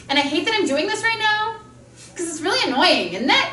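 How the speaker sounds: reluctant demo of rising statement endings; upspeak demo. Shown as a demonstration. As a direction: end each line on a lifted, questioning tune. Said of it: "I'm gonna do it".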